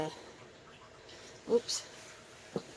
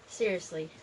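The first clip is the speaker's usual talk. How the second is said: ordinary delivery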